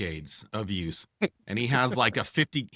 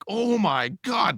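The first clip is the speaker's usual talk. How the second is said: high, slow, stunned gasp of words; mock shock; 10 semitones higher, 4 dB louder, slower than usual. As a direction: act out disbelief, stretched. Fire chief's jaw hits floor.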